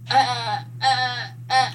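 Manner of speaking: mocking stammered hesitation; halting mock stutter; stuttering actress. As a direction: imitate someone stuck on halting filler sounds. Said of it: "is a stuttering goat".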